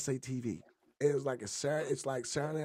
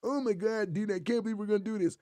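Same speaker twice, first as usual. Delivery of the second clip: fast and higher, hyped; lightly voiced eager friend; excited skit voice; 5 semitones higher than usual. One of four voices he did in one take, excited. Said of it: "me doing the voices".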